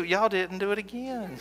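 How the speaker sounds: ordinary talk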